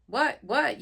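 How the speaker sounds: high, loud questioning yell. Acted. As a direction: shout it, straining to hear. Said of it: "yells Kitty".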